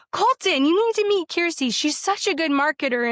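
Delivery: high, loud, gushing introduction; imitating a friend's excited pitch; eager calling out. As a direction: call him over and talk her up. Friend eagerly introducing her to Colton.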